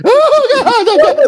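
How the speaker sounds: high, loud hammed-up wail; mock wailing. Parodying the president's wife death scene, a high loud mock wail. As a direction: overdo the grief.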